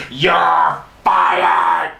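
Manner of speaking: booming drawn-out mock yell; imitated boss's dramatic shout; shouted firing. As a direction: bellow it for the whole room. Retold firing before locker room.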